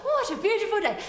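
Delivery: high, loud, laughing dreamy line. Pretend. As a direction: gush it through laughter.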